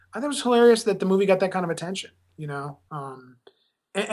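normal talking voice

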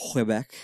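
Quebec with a deep throaty Q. Shown as a demonstration. Showing co-hosts Québécois speech, deep throaty Q on "Quebec". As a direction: say "Quebec" with the Q made back in the throat. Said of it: "deep throaty Qs".

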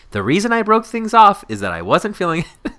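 high, loud, incredulous email reading; mocking read-aloud. Friend reading the breakup reply aloud, amused mockery. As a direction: read it out as a damning exhibit.

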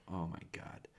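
low, quiet confession; sheepish. Retold or imitated. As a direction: admit it with your head hung. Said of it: "hang their head and go".

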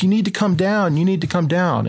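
mock shout up a tree, pitched high without much volume; shouted from below. Farmers urging the stranded pilot down, mild retold shout. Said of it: "they're shouting at me from way down below".

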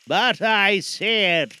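slow, eerie ghost voice; hollow spectre, unhurried; unearthly voice. Acted. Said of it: "the spectre's voice disturbed the very marrow".

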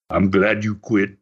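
lightly put-on Dutch accent, low; low-pitched retelling with a Dutch accent. Grandson quotes grandfather to his hurt opponent, Dutch accent. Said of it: "he says in this Dutch accent".

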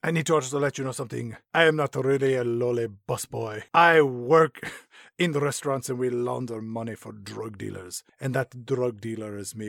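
put-on low confessional Latin accent. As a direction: reveal your secret in a hammed-up Latin accent. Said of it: "my sexy Latin accent".

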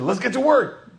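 ready to jump in and start; eager resolve. Retold or imitated. Hope rises, eager to start.

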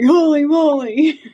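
slow, high, loud amazed exclaim; recalling her first bear, thrilled. Retold or imitated. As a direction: savor the thrill of the shot.

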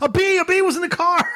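high-pitched mock alarm; panicked imitation. Son explaining the panic to dad, mock alarm. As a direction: stammer out the cause, imitating a panicked kid.

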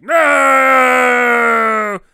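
sudden loud cry of no; shout. Retold or imitated. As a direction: yell it out, high and loud. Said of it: "Gollum's like".

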